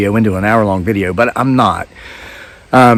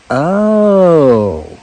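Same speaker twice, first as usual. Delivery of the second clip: Oh drawn out over a long rise and fall; knowing oh; 6 semitones higher than usual. Retold or imitated. To the yuppie mother, a long meaningful oh. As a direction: stretch the Oh into a knowing hum. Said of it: "now with that inflection".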